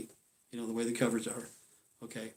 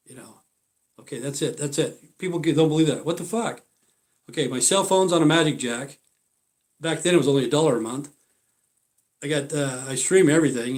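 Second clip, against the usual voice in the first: ordinary talk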